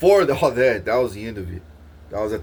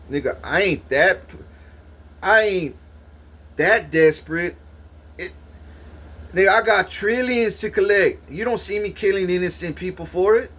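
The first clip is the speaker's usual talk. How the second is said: indignant disbelief; 7 semitones higher than usual. Retold or imitated. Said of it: "I was telling myself this".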